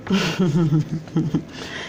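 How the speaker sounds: quiet, low chuckle to oneself. Real, not acted. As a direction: chuckle softly, low in the throat. Scoffs after rereading the quote.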